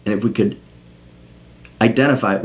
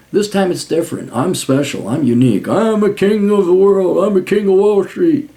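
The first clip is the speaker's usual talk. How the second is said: arrogant banker voice; 7 semitones higher than usual. Retold or imitated. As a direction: puff up like a cocky banker.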